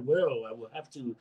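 low, halting Shatner-style delivery; lightly imitating Keith Lee as Captain Kirk; Shatner impression. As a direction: pause dramatically like Shatner doing a wrestling promo. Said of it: "he talked like William Shatner".

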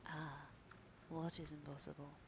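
soft, subdued murmur; quiet, understated question. A narrator's reading. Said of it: "murmured the young man".

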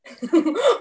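high, loud burst of laughter; real amused laugh. Real, not acted. Laughs at co-host's joke.

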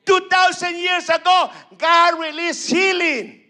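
loud, fervent preaching; fervent sermon building to a shout. Acted. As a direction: preach it out with full force. Preaching to a congregation.

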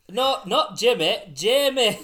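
very loud, high and slow northern parody; mock northern accent. Correcting the friend's Jamie, mock northern accent. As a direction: stretch the name in a hammed-up northern accent. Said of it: "that's probably not even the accent".

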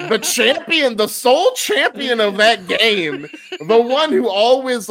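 giggling mock outrage; laughing disbelief. Real, not acted. Laughing over friend's betrayal.

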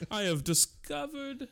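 mock gentleman. Joining the Banting bit, high slow mock proclamation. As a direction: announce it like a pompous old inventor.

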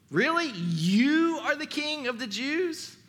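high, drawn-out scoffing question; incredulous. Acted. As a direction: stretch "you" in high disbelief. Pilate incredulous, emphatic you.

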